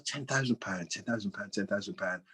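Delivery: mumbled to himself. Retold or imitated. Chairman musing over the sum on the phone, softly muttering. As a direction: mull the number under your breath.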